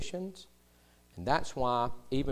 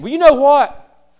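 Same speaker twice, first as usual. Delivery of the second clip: loud, high, slow exasperated insult; a mock outburst that has run out of arguments; hammed-up angry retort, loud and high; losing an argument; 11 semitones higher, 15 dB louder, slower than usual. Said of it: "you end up saying".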